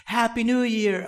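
hammed-up party greeting; mock new year cheer. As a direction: imitate people forgetting the birthday. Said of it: "it'll be like".

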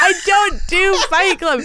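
high, slow pretend little girl; mock little-kid voice. Host plays along as her little self, high mock kid voice.

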